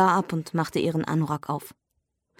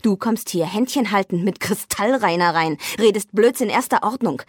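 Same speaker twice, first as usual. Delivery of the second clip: usual speaking voice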